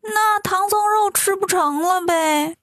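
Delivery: high, slow, let-down whine; mild disappointed moan; disappointed imps. A narrator's reading. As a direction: drag it out like sulking little demons. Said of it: "都挺失望".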